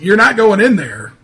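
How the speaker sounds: loud, insistent in-character command; stern order. Acted. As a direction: call them away from the door. Character orders players away from house.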